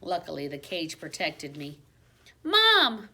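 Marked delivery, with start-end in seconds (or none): 2.4-3.1 high, loud call across the flat; yelling for mom. A narrator's reading. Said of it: "Miranda yelled".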